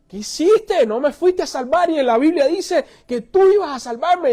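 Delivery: high, forceful complaint; acted outrage at being let down; indignant reproach. Drowned man in heaven questions God, indignantly.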